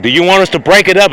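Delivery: high, fast, loud voice; squeaky rushed offer to help; bridesmaids asking. Retold or imitated. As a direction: bridesmaids chiming in high and quick. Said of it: "those bridesmaids said".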